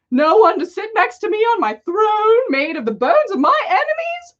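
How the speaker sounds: milk the loneliness for sympathy; self-pitying Dark Lord. A narrator's reading. Tom complaining to Albus about loneliness, melodramatic. Voldemort laments his loneliness.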